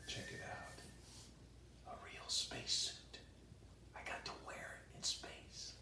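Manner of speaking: hushed secret whisper, slow. Acted. Astronaut whispering to brother about the suit. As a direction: breathe it like a secret.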